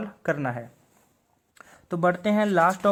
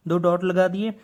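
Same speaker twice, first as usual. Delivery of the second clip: ordinary talk, nothing marked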